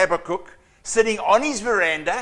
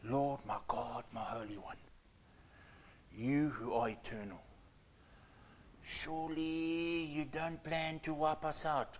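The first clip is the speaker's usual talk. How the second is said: soft, low, whispered prayer; hushed whisper; 3 semitones lower, 17 dB quieter than usual. Shown as a demonstration. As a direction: breathe it out like a frightened private plea. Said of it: "this is how I think he was speaking".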